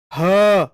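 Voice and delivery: ह as a loud, long breathy h; ह sound demo. Shown as a demonstration. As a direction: push out ह with breath friction. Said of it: "ह अघोष नहीं होगा बच्चा". (ज़ोर से घर्षण वाला ह)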